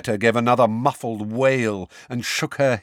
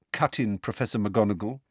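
usual speaking voice

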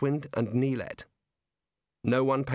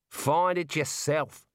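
put-on Australian girl voice; 3 semitones higher, much slower than usual. Neelet's lines in the story.

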